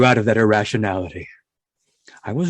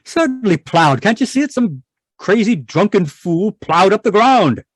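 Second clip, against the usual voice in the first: outraged; 8 semitones higher than usual. Acted. Husband outraged at plowed ground.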